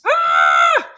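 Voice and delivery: loud high scream. Acted. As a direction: scream out in panic. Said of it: "Doug screams".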